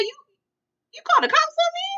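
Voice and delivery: high-pitched mock disbelief; shocked accusation. Mother confronting her son over the cops, mock shocked. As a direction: act out an affronted mother's question.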